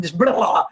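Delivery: loud blurted "blah"; blurting. Retold or imitated. Mocking people with no filter, loud blurting. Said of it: "They just blurt".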